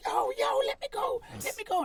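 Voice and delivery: mock yelp from someone pinned; high-pitched yelping. Bitten attacker begging to be released, high-pitched yelping. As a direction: imitate a pained plea, very high.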